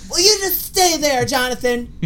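high pleading sitcom wife; 1950s housewife. Pretend. Wife voiced in 1950s sketch.

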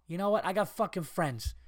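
usual speaking voice

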